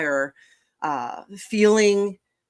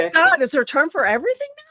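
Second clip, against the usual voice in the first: amazed; 8 semitones higher, 6 dB louder, faster than usual. Real, not acted. Amazed at there being terms.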